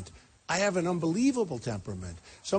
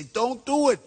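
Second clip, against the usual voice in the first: put-on higher, louder plea to kids; urgent warning; 4 semitones higher, 5 dB louder than usual. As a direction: implore them with raised pitch and force.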